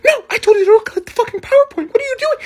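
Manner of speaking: very high, strained mock scolding; exasperated scolding. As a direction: imitate an exasperated mom squealing orders. Exasperated mom scolding the kid.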